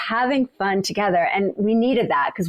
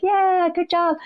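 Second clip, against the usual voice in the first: high, bright call of encouragement; cheery whoop; 11 semitones higher than usual. Retold or imitated. Runner cheering passing racers on the trail, high whooping call. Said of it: "I would, like, whoop at them".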